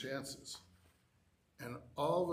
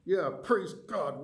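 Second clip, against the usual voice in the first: grudging praise through gritted teeth; 4 semitones higher, 6 dB louder, slower than usual. Retold or imitated. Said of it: "I'm just gritting my teeth".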